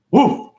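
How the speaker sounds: high, short woof; dog bark. Acted. Imitating a dog's bark.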